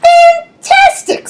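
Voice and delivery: very high, slow, drawn-out fantastic; a princess gushing at the dragon; gushing praise. Acted. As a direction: gush over the dragon.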